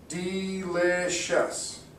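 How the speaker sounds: "Delicious" very slow, "De-li-cious"; slow syllable split. Shown as a demonstration. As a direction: spell out "De… li… cious" in beats. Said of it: "You clap three times".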